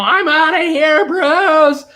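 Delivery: loud, high, slow huffy exit; playful fake walk-out, high and slow; mock storm-off. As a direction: flounce off in a hammed-up huff. Storming off from the chat.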